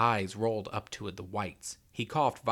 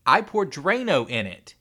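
matter-of-fact; 7 semitones higher, 12 dB louder than usual. A narrator's reading. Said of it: "he said matter-of-factly".

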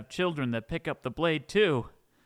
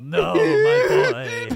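much higher, much louder, slow wailing cry; wailing sob; 9 dB louder, much slower than usual. Acted. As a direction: blubber it out in hammed-up grief. Father weeps reunion.

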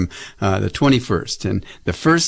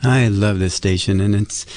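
ordinary delivery

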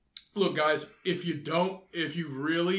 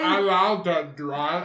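put-on basic girl voice; 4 dB louder than usual. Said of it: "Every, every basic girl voice".

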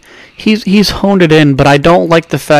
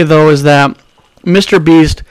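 ordinary talk, nothing marked

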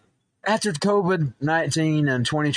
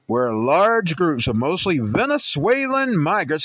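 news read; 3 semitones higher, 4 dB louder, slower than usual. Acted. Reads an article headline aloud.

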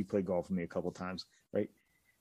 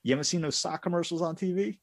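incredulous boss; 10 semitones higher, 3 dB louder than usual. Retold or imitated.

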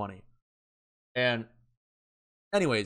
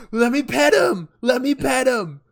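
high, loud gushing over a puppy; puppy excitement; 11 semitones higher, 7 dB louder than usual. Retold or imitated. Drunk teen squealing at a police puppy. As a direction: beg to pet the dog like a giddy kid.

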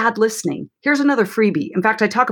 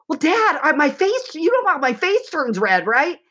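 higher, lightly voiced teen girl; chatty daughter; 8 semitones higher than usual. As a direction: play a teen girl spilling to dad. Daughter answers dad's question.

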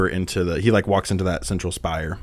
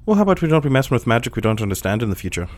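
quick, higher-pitched rebuke; arched-eyebrow telling-off in character; Cadius; 4 semitones higher, 4 dB louder, faster than usual. Acted. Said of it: "Cadius gives you a look, arches eyebrow, goes".

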